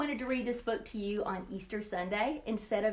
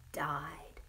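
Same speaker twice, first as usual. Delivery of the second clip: hushed whisper on the last word; 5 semitones lower, 8 dB quieter than usual. Acted. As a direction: breathe the word out like a secret. Jesus breaks sad news softly.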